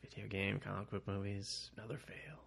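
retold letdown, trailing into near-silence; disappointed. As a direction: give up on it quietly. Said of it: "it was disappointing. I was like".

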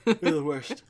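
yawning. Real, not acted. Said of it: "heard me through that yawn".

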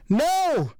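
high-pitched woman's scream of refusal; defiant scream. A narrator's reading. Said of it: "Saskia screamed defiantly".

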